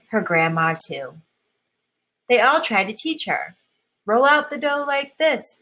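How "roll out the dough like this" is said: dad teaching. Acted. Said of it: "says Amy's dad".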